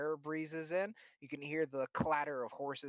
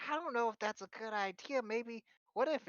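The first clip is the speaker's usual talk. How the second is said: put-on high, worried old lady; granny voice; 9 semitones higher than usual. Philia unsure about the blessing, high granny voice. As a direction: fret like a nervous grandma. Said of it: "she's like".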